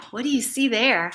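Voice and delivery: plain reading voice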